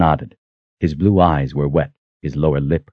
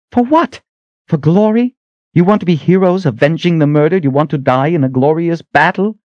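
much higher and louder, hurling each question; angry outburst; 12 semitones higher, 4 dB louder than usual. A narrator's reading. Kaelin rebuking the soldiers for seeking glory, angry outburst. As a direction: scold them sharply for chasing glory. Mother Confessor scolds the soldiers.

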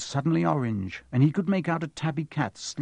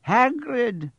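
Dumbledore voice; 10 semitones higher, 3 dB louder than usual. Acted.

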